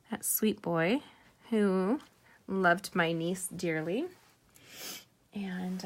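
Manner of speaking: normal talking voice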